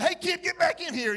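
put-on high, loud call after a kid; mock scolding shout. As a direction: playfully yell at a kid to come back. Acted scolding of elevator boy.

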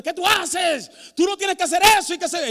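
high, loud, quick scolding shout; acted outburst from an indignant wife; wife's scolding. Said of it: "me dijo". (regaño de esposa a gritos, actuado)

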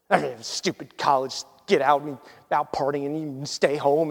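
low, soft grumble under the breath; grumbling, mad. Retold or imitated. Tow-truck pastor thinking to himself about a stranded kid, grumbling. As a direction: grouse privately, imitating someone annoyed. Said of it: "he said he was just mad".

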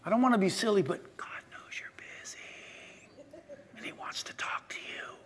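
near-whispered, intimate aside to the crowd; hushed, confiding preacher. Real, not acted. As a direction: drop almost to a whisper.

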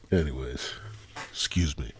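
ordinary delivery

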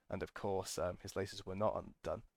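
ordinary talk, nothing marked

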